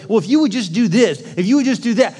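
a well-meaning parent correcting; nagging fixer. Retold or imitated. Parent to child, mildly nagging. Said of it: "you're always like".

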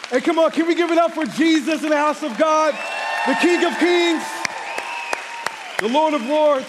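loud, high call to praise Jesus; rally the room, shouting with joy. Real, not acted. Pastor calling the church to cheer for Jesus, loud, high praise shout.

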